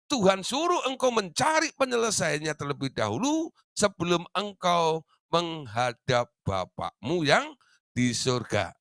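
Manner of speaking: ordinary talk, nothing marked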